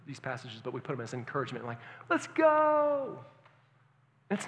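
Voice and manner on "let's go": high, put-on pep voice; much higher, hammed-up rally cry; mock hype. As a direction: parody a cheesy cheerleader. Mocking shallow encouragement.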